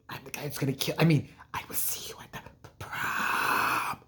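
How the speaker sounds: hushed sinister threat, dragged out; creepy killer whisper. Retold or imitated. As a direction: whisper it into the phone like a stalker. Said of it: "she's like".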